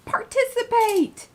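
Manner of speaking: high, drawn-out call; playful urging. Real, not acted. Host urging viewers into the chat, playful high call.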